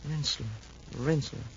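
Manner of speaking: quiet, thoughtful repeat of the name; hushed musing; muttering to himself. Acted. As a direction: murmur it while searching memory. Officer musing over a name.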